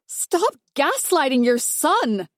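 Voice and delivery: high, slow, pointed shout; wife shouting at her mother-in-law over her son. Acted. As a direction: hurl the accusation slowly. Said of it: "I shout".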